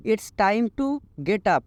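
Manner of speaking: very slow, each English word laid out clearly; slow teaching read. Shown as a demonstration. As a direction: model the sentence slowly for learners. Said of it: "उठने का समय आ गया है।".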